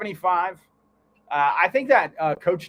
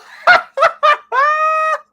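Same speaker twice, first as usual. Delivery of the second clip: loud, high burst of laughter; big laugh; 13 dB louder than usual. Real, not acted. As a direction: crack up hard, very loud. Cracking up at Samantha's arrival.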